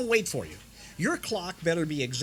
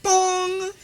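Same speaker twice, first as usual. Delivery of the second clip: much higher, louder long bell tone; imitated network bong; 14 semitones higher, 7 dB louder than usual. Radio network chime cutting in on the announcer, loud. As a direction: ring it out like a station chime. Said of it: "And you'd hear".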